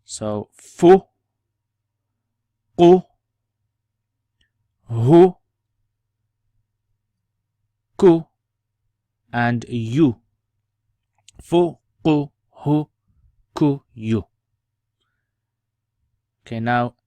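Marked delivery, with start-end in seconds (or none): 0.0-10.2 each syllable with a rounded "u", said loud; dhamma vowel u. Shown as a demonstration. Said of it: "add the dhamma". 11.4-14.2 each syllable with a rounded "u", said loud; dhamma vowel u. Shown as a demonstration. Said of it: "add the dhamma".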